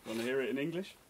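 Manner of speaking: ordinary delivery, nothing marked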